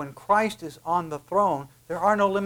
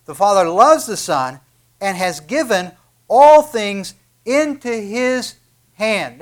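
proclaiming the verse; 4 semitones higher, 9 dB louder than usual. Acted.

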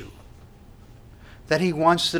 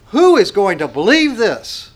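louder, higher, incredulous cry; 6 semitones higher, 9 dB louder than usual. Retold or imitated. Isaiah crying out to the people, loud and incredulous. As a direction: call it out as the prophet in disbelief. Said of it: "he cries out".

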